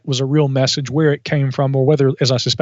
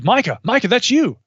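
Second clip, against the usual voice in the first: excited; 8 semitones higher than usual. Retold or imitated. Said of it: "everybody goes".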